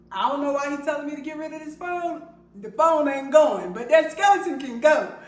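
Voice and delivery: loud, high, fast-starting objection; indignant protest. Retold or imitated. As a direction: protest it loudly, building to a firm refusal. Acting out her indignant reply.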